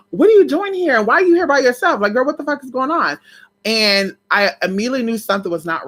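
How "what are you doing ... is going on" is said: high and loud at first, settling down; surprised questioning. Retold or imitated. Asking the cousin why she's there, a startled high voice.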